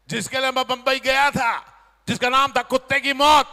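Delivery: loud rising shout to the crowd; building shout. Real, not acted. Comedian loudly shouts the punchline film name to audience. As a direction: hammer the film's name home. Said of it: "big reveal shout".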